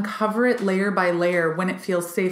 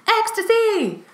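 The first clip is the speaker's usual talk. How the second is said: much higher ecstatic exclaim Ecstasy; mock ecstatic cry; 13 semitones higher, slower than usual. Acting out the oracle figure, high ecstatic cry. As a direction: throw the head back and cry it. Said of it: "head back in ecstasy".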